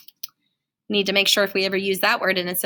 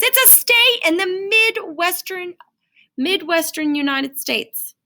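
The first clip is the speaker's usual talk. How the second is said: usual speaking voice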